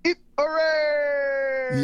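drawn-out high shouted hooray; joyful cheer. Real, not acted. Cheering the radio announcement.

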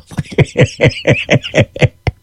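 long, breathy chuckling laugh; wheezy chuckle. Real, not acted. Laughing at his own restaurant remark, real wheezy chuckle. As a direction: laugh in a run of short airy bursts.

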